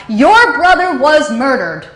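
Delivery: loud, slow, half-hearted echo of a scream; retold scream. Mother screaming the news of the murder down the phone. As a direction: let the news tear out, slowly. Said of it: "she finally screamed out".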